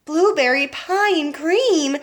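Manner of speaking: shouted. A narrator's reading. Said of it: "shouted Violet".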